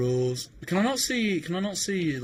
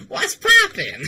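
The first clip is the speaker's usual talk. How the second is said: high, loud greeting through laughter; laughing shriek of a greeting; laughing squeal; 12 dB louder, much slower than usual. Real, not acted. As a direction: squeak it out while cracking up.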